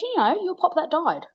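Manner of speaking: put-on a child stating the obvious; matter-of-fact toddler. Toddler explaining to mum which ghost, high patient child voice. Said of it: "And she goes".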